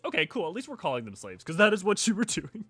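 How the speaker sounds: ordinary talk, nothing marked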